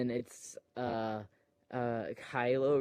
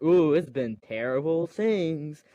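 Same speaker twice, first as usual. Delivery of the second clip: louder, slow hammed-up dismay; mock dramatic dismay; 3 semitones higher, 6 dB louder than usual. Rey picking up the dagger, mock dramatic dismay. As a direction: draw it out in mock horror. Retelling Rey's reaction to dagger.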